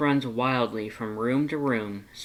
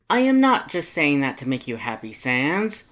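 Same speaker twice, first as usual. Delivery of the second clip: booming cheerful Papyrus; 2 semitones higher, 5 dB louder than usual. Acted. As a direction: boom it out, eager and proud. Papyrus voiced by narrator.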